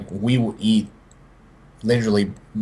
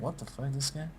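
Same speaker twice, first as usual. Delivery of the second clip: muttered disbelief; 2 semitones higher, 16 dB quieter than usual. Retold or imitated. Coworkers eyeing him as he announces donuts, hushed mock mutter. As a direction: mutter it like they're judging him under their breath.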